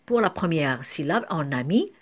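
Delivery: usual speaking voice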